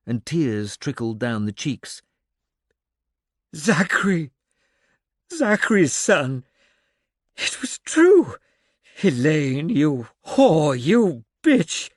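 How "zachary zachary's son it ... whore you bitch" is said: loud, high raging outcry; anguished rage. A narrator's reading. The old man realizes the truth and rages at Elaine, loud and anguished. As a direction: scream the betrayal out.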